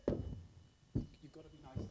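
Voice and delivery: slow, hushed whisper. Real, not acted. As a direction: whisper it so the shy ghost isn't scared. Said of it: "Gotta be quiet".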